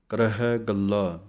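ordinary delivery

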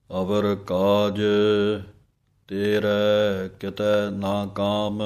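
ਅਵਰ ਕਾਜ ਤੇਰੇ ਕਿਤੈ ਨਾ ਕਾਮ sung slowly, each word separated; words kept apart. Shown as a demonstration. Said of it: "ਨਖੇੜ ਨਖੇੜ ਕੇ ਬੋਲਣਾ ਹੈ". (ਸ਼ਬਦ ਨਖੇੜ ਕੇ ਹੌਲੀ ਗਾਇਨ)

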